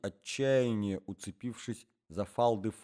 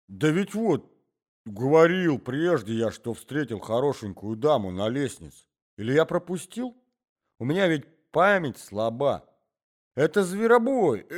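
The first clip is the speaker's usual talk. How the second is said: put-on old man's voice; 7 semitones higher, 10 dB louder than usual. A narrator's reading. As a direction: ramble like a doddering grandfather. Old man rambling.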